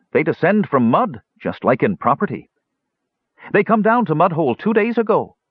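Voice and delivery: high, eager little dwarf voice; a simple-minded gully dwarf, eager to please. Acted. Gully dwarf answers in pidgin.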